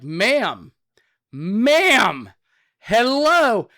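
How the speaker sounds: building to a high, drawn-out holler; escalating loud call. Retold or imitated. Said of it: "I'm a loud guy".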